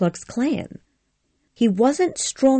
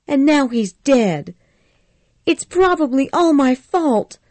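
put-on higher, pleading young woman's voice; upset Scarlett; 8 semitones higher, 3 dB louder than usual. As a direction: Scarlett fretting guiltily to Rhett, higher. Scarlett near tears, guilty.

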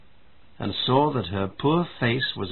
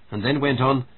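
ordinary delivery, nothing marked

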